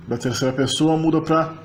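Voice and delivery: ordinary talk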